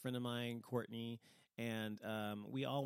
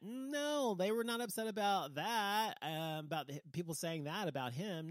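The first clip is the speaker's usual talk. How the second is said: much higher, louder, real indignation; indignant outburst; 7 semitones higher, 4 dB louder than usual. Real, not acted. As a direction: fire back at the hypocrisy. Angry rant about double standards.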